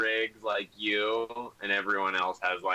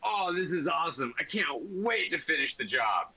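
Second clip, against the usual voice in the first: very high, thrilled outburst easing down a little; so excited. Acted. Game master as the guy at the truck window, so excited. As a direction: gush like an overjoyed fan, pitched way up. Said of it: "the guy gets so excited".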